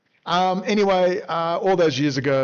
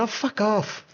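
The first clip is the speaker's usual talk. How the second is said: Leon brushing it off; 9 semitones higher, slower than usual. Retold or imitated. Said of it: "And Leon said".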